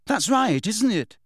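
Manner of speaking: high-pitched, urging question. A narrator's reading. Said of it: "Harry urged him".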